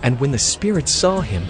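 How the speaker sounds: normal talking voice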